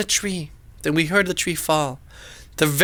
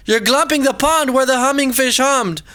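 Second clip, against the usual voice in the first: plain reading voice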